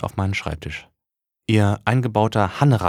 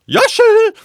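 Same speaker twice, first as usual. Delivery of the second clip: high, loud call through the door; mother calling through a locked door; 12 dB louder than usual. Acted. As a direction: call the son's name from the hallway. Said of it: "rief meine Mutter".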